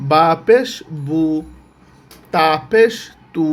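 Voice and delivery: each পেশ punched upward, then a separate u syllable like বু; spelling drill. Shown as a demonstration. Teacher reciting pesh letters for learners, rhythmic spelling drill. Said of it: "এইভাবেই পড়তে হবে".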